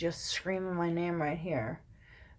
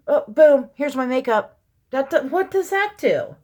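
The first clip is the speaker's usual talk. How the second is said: loud, high exasperated outburst; worked-up venting; 5 semitones higher, 8 dB louder than usual. Real, not acted. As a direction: scoff loudly in disbelief. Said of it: "I had to vent".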